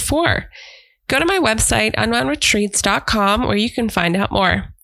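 steady, projected announcement read; promo read. Acted. Host inviting listeners to a self-care retreat, promo read.

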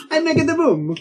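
high, put-on Borat accent; Borat-style accent. Caster deceiving the creature, high Borat-style accent. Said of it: "new Varys accent".

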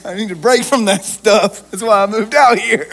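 chuckling through the words; laughing. Real, not acted. Pastor joking with the congregation about moving away, laughing. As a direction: laugh while you say it.

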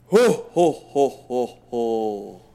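loud booming Santa laugh; evil laugh. Pretend. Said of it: "Give me your best evil laugh".

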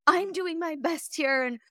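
high, strained whine of a frazzled mom; high, put-upon pleading; mock exasperated whine. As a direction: whine it like you're at the end of your rope. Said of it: "instead of being like".

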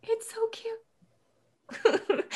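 breathless, high, drawn-out "so cute" with a laugh; gushing whisper. Acted. Winley cooing at the magic quill, high breathy gushing. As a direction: coo over it like a cute pet. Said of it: "And then Winley goes".

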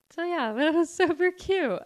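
high, giggly delight; laughing gush. Real, not acted. Reacting to the couple's cute ending, laughing gush. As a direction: say it through a laugh, pitched well up.

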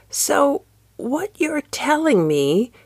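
put-on high, slow elderly lady voice; quavering old woman. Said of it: "in the quavering voice of the elderly".

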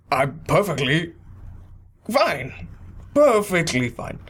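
forced cheer, clearly not fine; insisting she's fine while cracking; tone contradicting words. Acted. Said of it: "you certainly don't sound it".

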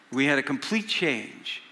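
ordinary talk, nothing marked

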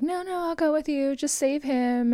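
high, pleading princess voice; pleading Buttercup. Retold or imitated. Podcaster imitating Buttercup pleading to save Wesley, high and pleading. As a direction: beg in a high, breathy-free plea. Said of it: "Buttercup is like".

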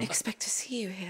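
breathless startled whisper; gasp, then whisper it. Acted. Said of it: "Someone's there".

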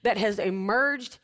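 fervent preacher building her case; impassioned preaching. Real, not acted. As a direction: preach it with conviction from the soapbox.

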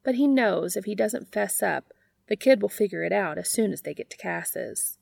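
ordinary delivery

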